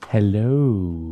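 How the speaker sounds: deep, slow sultry hello. Pretend. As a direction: purr it low and seductive. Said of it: "Did you enjoy my sultry voice for you?".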